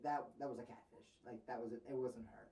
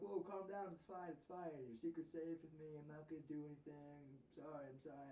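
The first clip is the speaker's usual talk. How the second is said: imitated placating; 2 semitones higher, 7 dB quieter than usual. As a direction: imitate someone backing down, ever quieter. Scammer placating, acted out.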